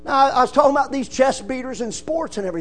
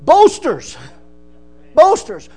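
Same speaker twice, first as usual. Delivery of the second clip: loud denunciation; 7 semitones higher, 8 dB louder, slower than usual. Acted. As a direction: shout the word out, then fall. Preacher denounces boasters.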